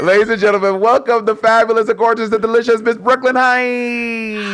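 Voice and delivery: high, sustained emcee call; grand showbiz introduction; announcer introduction. Pretend. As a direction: herald the guest, stretching the final name. Announces the guest.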